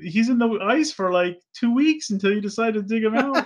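high-pitched incredulous complaint; mock outrage. Fan berating the Autobots over two weeks in ice, incredulous.

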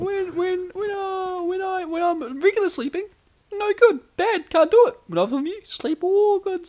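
squeaky high falsetto broken English; cartoon mouse, high and slow at the end; Mickey Mouse voice. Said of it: "trying to be Mickey Mouse".

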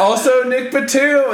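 high, mock-excited name drop; mock eager self-quote. Retelling what he said to French fans, mock high eager. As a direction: pitch up like pitching a hopeful idea. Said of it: "And I was like".

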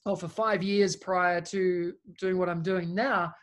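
ordinary talk

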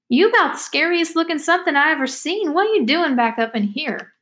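put-on high, swooping girl's voice; lilting backwoods girl sizing up a stranger; talkative country girl, astonished. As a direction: pitch it high and lilting, full of disbelief.

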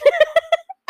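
loud, high laugh; burst of laughter. Real, not acted. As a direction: laugh out loud at your own story. Laughs at her own retelling.

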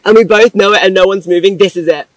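loud, giddy emphasis; excited retelling. Retold or imitated. Woman retelling two feet touching, giddy and loud. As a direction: build the silly suspense, loudly.

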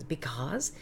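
"because" breathed with no voice; whispered take of "because". Shown as a demonstration. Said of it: "I must have said it fifty times".

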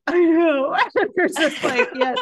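high, loud, thrilled agreement; high laughing yes of relief. Retold or imitated. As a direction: burst out with delighted recognition. Thrilled recognition of her feeling.

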